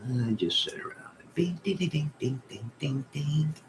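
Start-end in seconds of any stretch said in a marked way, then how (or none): 1.3-3.5 high, soft sung dings; plinking piano noises. Retold or imitated. Bored in the hospital, imitating the piano, high soft dings. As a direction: tinkle it out like idle piano keys.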